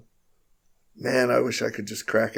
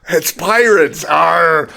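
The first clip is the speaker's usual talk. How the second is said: loud, high mock pirate growl; hammed-up pirate arr; 6 semitones higher, 13 dB louder, much slower than usual.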